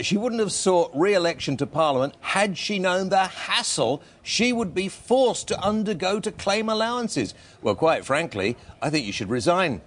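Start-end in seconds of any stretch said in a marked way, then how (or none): 0.0-7.3 high-pitched mimicry of an MP; high, affected quoting voice; put-on MP voice. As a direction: quote her in a high mock voice. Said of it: "why I did that voice".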